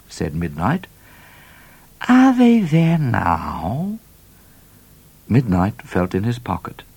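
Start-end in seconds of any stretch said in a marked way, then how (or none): 2.1-4.0 much higher, slow, pointed question; knowing Cat. A narrator's reading. Cat pressing Midnight about the loot, slow. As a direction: draw it out knowingly.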